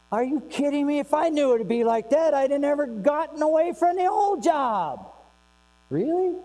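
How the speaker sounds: high-pitched, mock exasperated complaint. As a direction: act out a fed-up new employee, pitch climbing. Acts out disillusioned new employee.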